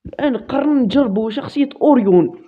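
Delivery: usual speaking voice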